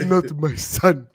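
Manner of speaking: very slow put-on accent, mock solemn; Kofi's mom. Showing what she did, slow mock-solemn mother's accent. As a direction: draw out each word in the mother's accent. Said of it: "This is exactly what she did".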